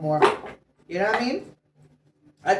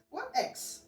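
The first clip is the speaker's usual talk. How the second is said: a hushed, lifted echo of the question; soft high echo; 10 semitones higher, 10 dB quieter than usual. Acted. Echoing the partner's question to the viewer, high and hushed. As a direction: repeat it much higher and much softer. Repeats the quote, much softer.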